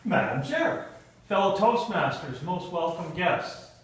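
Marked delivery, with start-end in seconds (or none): none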